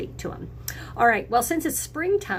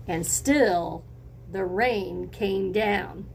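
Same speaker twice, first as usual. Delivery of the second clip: usual speaking voice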